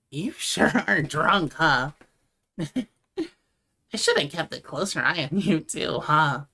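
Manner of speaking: loud, laughing teasing; laughing tease. Acted. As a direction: laugh while teasing her. Teasing drunk friend while laughing.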